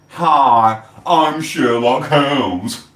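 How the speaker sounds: slow, drawn-out mocking greeting; mock Sherlock intro. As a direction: sneer the fake name slowly. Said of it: "why don't you use your own name".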